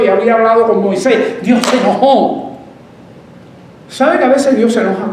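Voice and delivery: high, driving sermon narration; forceful preaching. Acted.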